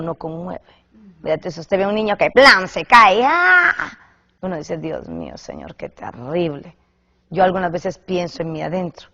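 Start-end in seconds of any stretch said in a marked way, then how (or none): 3.2-3.9 put-on high yelp of a falling child; a quick startled yelp; mock cry. Imitating a toddler falling in a tantrum, high yelp. 4.8-6.7 low, soft shocked murmur; hushed dismay. Retold or imitated. Onlooker quietly dismayed at a child's tantrum.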